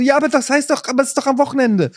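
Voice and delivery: high-pitched, hurried mock whine; retold whining protest. Protesting to his wife that it's the weekend, high mock whining. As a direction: plead your case like a thwarted snacker. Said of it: "ich motz meine Frau an".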